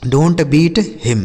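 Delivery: each word said very slowly and clearly; slow clear English. Shown as a demonstration. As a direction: dictate it slowly for learners. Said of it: "जैसे". (बहुत धीरे, साफ़ अंग्रेज़ी उच्चारण)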